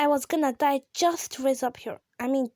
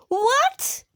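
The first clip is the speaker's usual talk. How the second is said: much higher, loud startled yelp; shocked what; 12 semitones higher, 7 dB louder than usual. Real, not acted. Kid startled by something in the game, surprised yelp. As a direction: jolt out the word in disbelief.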